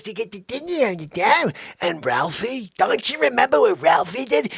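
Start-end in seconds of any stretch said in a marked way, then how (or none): none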